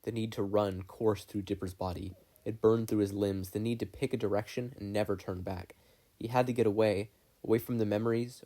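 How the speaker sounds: ordinary delivery, nothing marked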